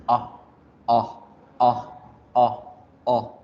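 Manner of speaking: "O" said with the mouth open as for A; short "O" between ah and oh. Shown as a demonstration. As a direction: drop the jaw for separate brief "O"s. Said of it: "la O corta".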